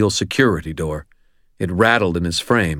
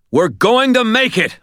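high, loud rallying shout; fired-up, raised pep-talk yell; 5 dB louder than usual. A narrator's reading. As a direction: shout it to spur him on. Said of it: "I shouted".

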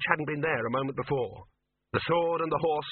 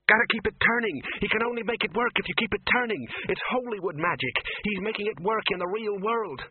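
put-on urgent girl's voice; 9 semitones higher than usual. A narrator's reading. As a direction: shout it over the noise. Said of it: "That was a girl's voice".

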